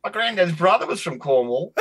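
put-on very high-pitched old Aussie local; Australian accent. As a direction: imitate an old Australian amazed by the connection. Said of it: "You, you do that accent well".

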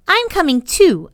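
put-on high, loud little girl voice; a small girl insisting; little girl announcing. A narrator's reading. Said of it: "announced Nanny".

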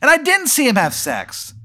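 very high-pitched objection; indignant protest. Real, not acted. Player protesting his character's punishment, indignant protest. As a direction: plead innocence, voice shooting up.

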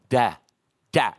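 toddler babble, lightly voiced; baby saying da. Preacher imitating his sons' first word. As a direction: mimic a baby's first word. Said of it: "I'm gonna try to imitate it".

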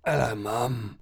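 groaning, faint patient voice; weak, sick son. Acted. Sick son telling mum he collapsed, weak and groaning. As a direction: answer feebly, with groans and pauses.

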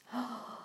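soft breathy gasp of wonder; quiet delighted gasp. Acted. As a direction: catch your breath at the flowers. Dorothy sees dazzling poppies.

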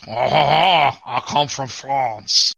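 slow, drawn-out mock French accent. Co-host answers the French accent bit, in slow mock French accent. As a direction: stretch it out in a hammed-up French accent. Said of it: "my French accent is very good".

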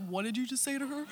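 husband's soft warning over his sunglasses; quiet menacing husband. Retold or imitated.

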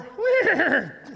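high, drawn-out vocal sound effect; imitated noise. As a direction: mimic a passing noise with a high voiced whine. Imitates what passes the horse cart.

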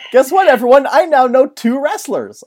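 loud, high, eager announcement; excited announcement. Real, not acted. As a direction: announce it with glee. Announcing to listeners.

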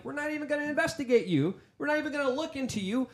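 high-pitched imitation of officials refusing; mock dismissive officials. UK police refusing to investigate Prince Andrew, mock dismissive.

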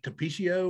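Tapatio said "tuh-PEE-shee-oh"; unsure mispronunciation. Shown as a demonstration. Friend admitting how he says the sauce, Tapatio as "tuh-PEE-shee-oh". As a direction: turn the "tio" of Tapatio into "shee-oh". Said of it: "I'd say it Tapatio or something like that".

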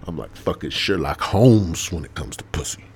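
ordinary talk

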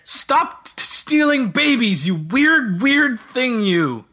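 high, swooping hammed-up outrage; mock indignant scolding. Player berates the baby-stealing wizard, mock indignant. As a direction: stammer into a drawn-out playful telling-off.

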